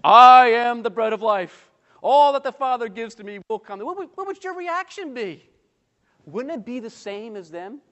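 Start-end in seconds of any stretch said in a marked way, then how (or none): 0.0-3.8 acted solemn declaration; imitated grand claimant. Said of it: "he starts to talk like this". 3.8-5.5 much higher, baffled asking; high-pitched disbelief; incredulous question. Acted. Repeats question after the startling claim.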